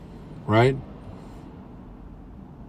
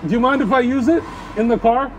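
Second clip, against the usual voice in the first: yelling over leaf blower; 8 semitones higher than usual. Real, not acted. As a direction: call out over the noise. Shouting to worker over blower.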